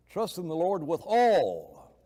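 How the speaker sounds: slow, with "all" drawn out and falling; emphasis on all. Shown as a demonstration. Preacher building the verse piece by piece, stressing "all". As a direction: lean hard on a long final "all". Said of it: "emphasize every word because there's an emphasis".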